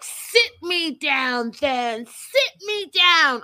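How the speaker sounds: hammed-up confrontational shout, slow and loud; in-her-face defiance. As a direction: imitate a defiant challenge, stretched and rising in force. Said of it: "all in her face".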